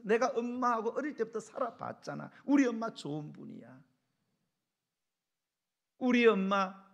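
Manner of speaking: acted indignant defence; defensive husband. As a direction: stand up for your mother to your wife. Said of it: "남편들이 뭐라고 얘기합니까".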